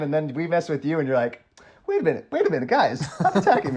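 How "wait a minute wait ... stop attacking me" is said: high, whiny, mock-defensive voice; hammed-up mockery of a defensive friend; mock whiny defensiveness.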